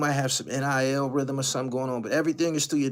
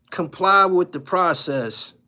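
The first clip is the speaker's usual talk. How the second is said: loud, slow firm order; stern command; 5 semitones higher, 9 dB louder, slower than usual. Real, not acted. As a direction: lay down the law slowly. Mock stern advice to player.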